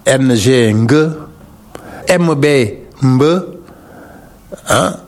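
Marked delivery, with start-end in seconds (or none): 2.1-3.2 mb hummed straight into the b, one sound; prenasal mb. Shown as a demonstration. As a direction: blend mb together, nasal then b. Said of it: "tout ce qui est écrit par M B".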